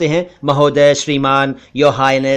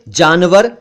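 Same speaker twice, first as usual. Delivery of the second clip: ordinary talk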